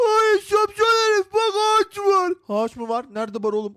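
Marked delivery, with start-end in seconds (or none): 0.1-2.3 mock scolding shout. Scolding friend in game banter.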